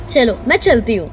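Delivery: usual speaking voice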